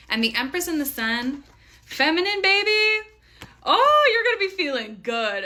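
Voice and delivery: high, loud gleeful exclamation; excited hyping. Real, not acted. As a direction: cheer the listener on with soaring pitch. Hyping the viewer over the cards.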